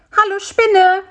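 high, loud little fly's call; loud, high-pitched hail. A narrator's reading. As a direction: call to the spider in a high, small voice. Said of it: "rief Bisi".

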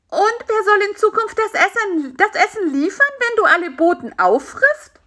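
high-pitched, reproachful question; exasperated fly demanding an answer; indignant fly. Acted.

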